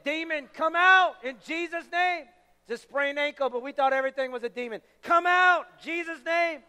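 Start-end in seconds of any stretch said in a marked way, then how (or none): 0.0-2.2 loud, high yell casting out a demon; yelled exorcism command. Retold or imitated. Students praying over an injured friend's ankle, yelling at a demon. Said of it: "we just yelled louder and louder". 5.0-6.6 high, loud shouted command to a demon; yelled exorcism. Retold or imitated. Students shouting over a sprained ankle, yelling louder and louder. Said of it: "we just yelled louder and louder".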